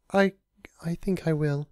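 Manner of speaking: high, hesitant; timid high reply; shy Fluttershy. A narrator's reading. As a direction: agree shyly. Fluttershy voiced.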